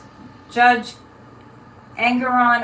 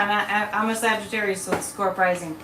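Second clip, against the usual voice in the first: normal talking voice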